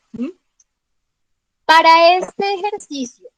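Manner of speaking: normal talking voice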